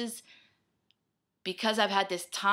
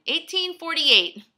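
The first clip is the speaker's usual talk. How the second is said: disbelieving exclamation; 9 semitones higher, 10 dB louder, slower than usual. Real, not acted. Repeats time in disbelief.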